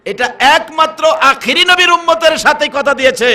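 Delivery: loud, high-pitched sermon proclamation; impassioned preacher in full cry; full-force preaching. Real, not acted. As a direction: drive it out to the whole congregation.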